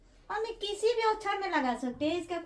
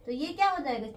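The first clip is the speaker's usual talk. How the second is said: ordinary talk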